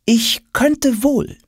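very high and slow, lofty refusal; drawn out, aloof and superior; haughty queen. Acted. Kleopatra coolly refusing Tom.

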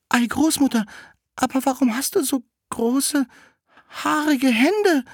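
put-on high-pitched little-girl voice; Rotkäppchen questioning. Rotkäppchen to the disguised wolf in bed, high questioning voice. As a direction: ask in a high, put-on child voice.

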